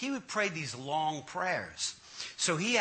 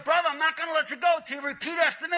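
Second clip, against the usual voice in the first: high, fast mock-pastor demand; insistent preacher impression; 8 semitones higher, much faster than usual. Pastor refusing to release man, insistent high voice. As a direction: badger him like a zealous preacher.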